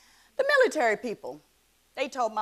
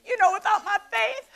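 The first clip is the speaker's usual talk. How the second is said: much higher voice, close to crying; real tearful testimony; 8 semitones higher than usual. Real, not acted. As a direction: hold back real tears while testifying. Tearful testimony about faith and coping.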